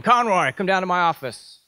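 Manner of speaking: called out high and loud across the unit; calling out a name. Retold or imitated. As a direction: summon him loudly from the doorway. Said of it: "he called out my name".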